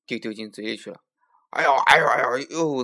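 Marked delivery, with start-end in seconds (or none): 1.5-2.8 loud, startled ouch cries; yelping in pain. A narrator's reading. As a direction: yell out as if the mouth burns. Tiger yelps from hot pepper.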